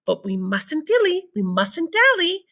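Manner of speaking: sing-song urging to hurry; a touch of a fussy Wonka; playful hurry. A narrator's reading.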